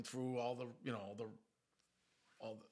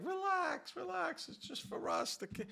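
high, soothing mock wife voice, much higher; imitating wife; 3 dB louder than usual. Wife tells complaining husband to relax, high mock-soothing. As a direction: play the wife calming him down in a high voice. Wife calming him about the house.

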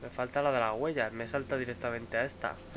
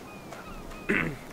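throat clearing; 9 semitones higher than usual. Real, not acted. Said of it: "sigo resfriado".

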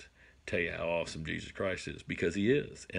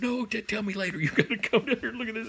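much higher, fast, with a laugh; excited, laughing; 14 semitones higher, faster than usual. Retold or imitated. Father cutting off his son to show the buck, excited. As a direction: brush him off, too thrilled to listen. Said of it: "I'm just all excited".

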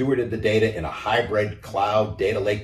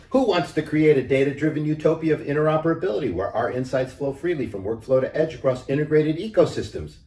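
higher, fast hen voice pitching an idea; eager hen; 7 semitones higher than usual. A narrator's reading. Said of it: "asked the little red data hen".

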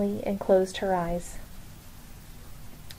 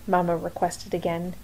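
ordinary delivery

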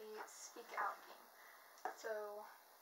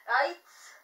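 loud, slurred through a mouthpiece; wearing a mouthguard; 4 semitones higher, 14 dB louder than usual. Real, not acted. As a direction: shout past a mouthguard. Reading card with mouthpiece in.

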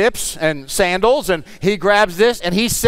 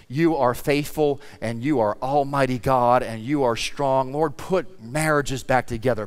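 softer, hushed pastoral prayer voice; closing prayer; 4 semitones lower, 6 dB quieter than usual. Acted. Pastor praying for the church, hushed and earnest. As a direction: pray gently over the congregation.

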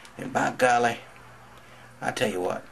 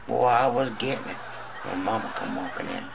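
usual speaking voice

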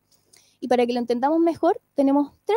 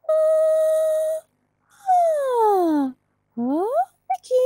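much higher light head voice; 3 dB louder, much slower than usual. Shown as a demonstration. Teacher demonstrates head voice, sounding like Mickey Mouse. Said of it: "sería esta voz".

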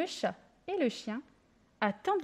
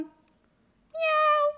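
high loud meow; kitten voice; 5 dB louder than usual. A narrator's reading. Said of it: "le chat miaule".

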